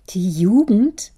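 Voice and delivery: father repeating his daughter's word with disbelief; incredulous echo. Acted. Otto questions incredulously.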